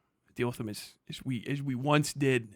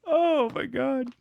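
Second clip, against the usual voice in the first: drawn-out, much higher, disbelieving groan; exasperated oh my God; 12 semitones higher, 4 dB louder, much slower than usual. Real, not acted. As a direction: stretch it out slowly in utter disbelief. Exasperated at hater comments.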